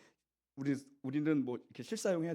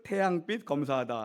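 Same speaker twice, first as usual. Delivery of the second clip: ordinary delivery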